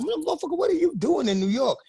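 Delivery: high, incredulous mock outrage; high disbelieving swear at an old tour friend; retold surprise. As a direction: act stunned to see him here. Shocked at friend in New York.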